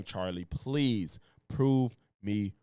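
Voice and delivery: normal talking voice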